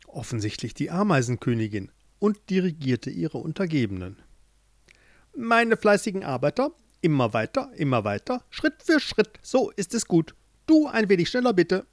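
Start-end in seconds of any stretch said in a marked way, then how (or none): 5.4-11.9 high, bossy queen giving orders; commanding ant queen. Acted. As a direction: drive the workers on from above. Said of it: "kommandierte sie".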